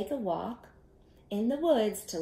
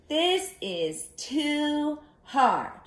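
high, slow, louder complaint; slow high fussy voice; Goldilocks complaining; 8 semitones higher, 4 dB louder, much slower than usual. Acted. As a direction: whine it like a fussy girl.

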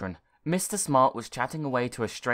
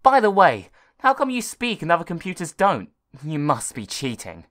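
higher, much louder cheeky voice; raised bratty kid; accusing boy; 5 semitones higher, 8 dB louder than usual. Acted. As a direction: challenge the computer suspiciously.

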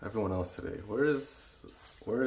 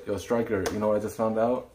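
normal talking voice

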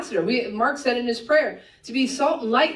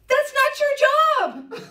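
emphatic punchline; 3 dB louder, slower than usual. Real, not acted. Preacher punchline to congregation.

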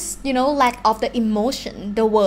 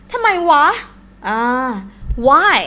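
exasperated; 4 semitones higher, 5 dB louder, faster than usual. Shown as a demonstration. Acted exasperation demo.

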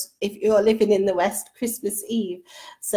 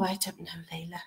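whisper; 6 semitones lower, 16 dB quieter, faster than usual. Real, not acted. Secret answer whispered on camera.

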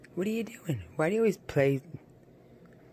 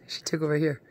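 ordinary talk, nothing marked

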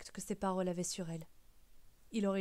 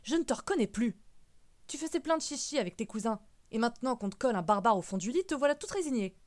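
loud, exasperated scolding; indignant outburst with swooping pitch; bad-tempered rebuke; 3 semitones higher, 7 dB louder than usual. A narrator's reading. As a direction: scold your niece, voice raised and climbing. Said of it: "La mauvaise humeur de l'archiviste".

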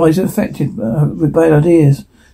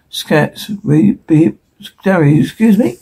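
ordinary delivery

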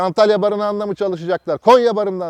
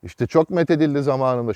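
normal talking voice

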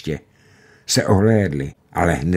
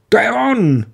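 much higher, unnatural wild shout; wild cry; 4 dB louder than usual. A narrator's reading. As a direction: burst out in disbelieving joy. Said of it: "vykřikl někdo takovým nepřirozeným a divokým hlasem".

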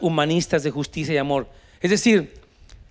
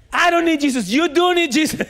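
mock angry cop; 10 semitones higher than usual.

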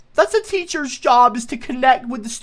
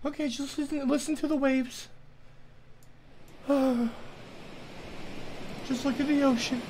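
soothing self-talk; 12 dB quieter than usual. Acted. Talks himself down, soothing.

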